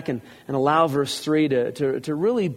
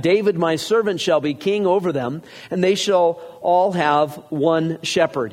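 raised, steady reading of scripture; 3 semitones higher than usual. Acted. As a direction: read it out to the congregation. Reads scripture aloud.

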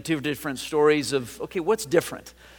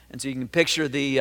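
usual speaking voice

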